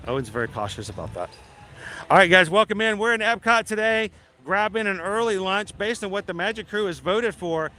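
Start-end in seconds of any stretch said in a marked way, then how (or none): 2.1-7.7 vlog host intro. Acted. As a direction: greet the viewers with energy.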